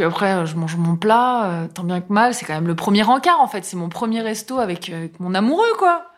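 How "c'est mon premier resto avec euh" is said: ordinary talk, nothing marked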